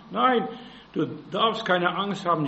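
ordinary talk

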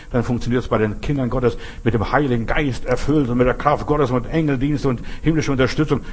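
ordinary talk

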